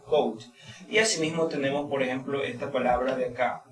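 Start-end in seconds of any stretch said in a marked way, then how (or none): 0.1-0.4 cold, ending clearly on the D; cold with final D. Shown as a demonstration. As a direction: say cold, closing on the D. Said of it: "mire la pronunciación de la D al final".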